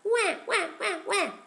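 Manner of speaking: high-pitched mock baby wail; baby wah cries. Narrator voicing Egyptian baby, high mock wail. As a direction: cry out like a smacked baby. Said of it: "would start crying".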